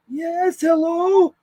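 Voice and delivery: very high, loud put-on voice; put-on phone voice. Answering folks who call to scam you, very high put-on voice. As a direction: answer in a silly falsetto. Acts silly voice for scam callers.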